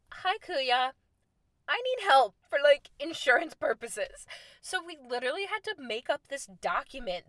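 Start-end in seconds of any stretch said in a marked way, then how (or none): 0.1-4.3 higher, louder, sugary plea for help; pleading, sweet-talking. Retold or imitated. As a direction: sweet-talk him into helping, pitched up. Begging a local for help.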